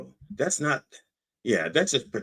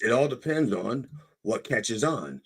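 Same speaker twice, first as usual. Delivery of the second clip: ordinary talk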